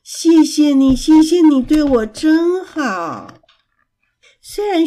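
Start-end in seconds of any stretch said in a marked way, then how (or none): 0.0-3.4 slow, louder, moved thanks; deeply touched. Acted. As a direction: thank your friend with real emotion. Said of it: "古堡非常感动地说". (非常感动地慢慢道谢)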